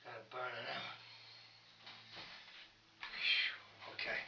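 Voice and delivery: winded after a hard set; breathless, puffing after exercise; out of breath. Real, not acted. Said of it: "Let me catch my breath".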